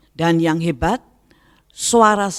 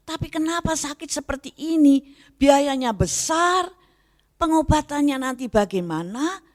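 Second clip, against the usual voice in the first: complaining to God; 8 semitones higher than usual. Retold or imitated. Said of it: "saya agak marah".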